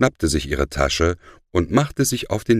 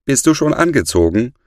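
higher, louder call from downstairs; mother calling up; 10 semitones higher, 5 dB louder, faster than usual. A narrator's reading. Mother to Bert upstairs at breakfast time, calling. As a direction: call up to someone on another floor. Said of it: "hörte er von unten die Stimme seiner Mutter".

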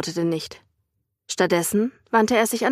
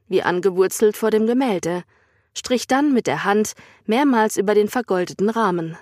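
usual speaking voice

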